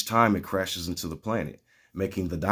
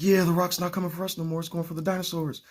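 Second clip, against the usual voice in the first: gleeful crowd; 10 semitones higher, faster than usual. Retold or imitated. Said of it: "Everyone's like".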